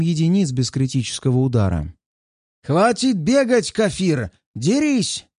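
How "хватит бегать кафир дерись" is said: high, slow, barked challenge; harsh barking commands. Acted. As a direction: bark it at a fleeing foe. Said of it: "рявкнул он".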